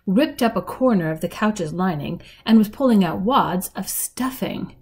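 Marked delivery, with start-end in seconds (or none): none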